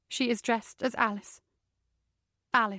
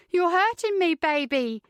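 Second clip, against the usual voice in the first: high, mocking and pleased; with a smile; 7 semitones higher than usual. A narrator's reading. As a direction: smile through the pain to taunt him. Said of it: "she says with a smile".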